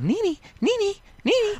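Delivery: high, thin little mocking calls; mimicked name-calling. Host mimics a castmate fawning over her friend, high mocking calls. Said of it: "she's kinda like".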